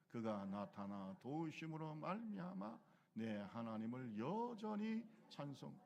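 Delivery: slow, measured psalm reading; leading the psalm reading, slow and measured; slow responsive reading. Acted. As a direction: read the psalm slowly with the congregation. Congregation reads psalm together.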